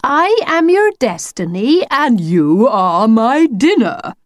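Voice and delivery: high start, slow drawn-out ending; theatrical chorus line. Acted. Lucy and the wolf recite his line together, grandly declaimed. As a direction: declaim it as a grand mock threat. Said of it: "they said together".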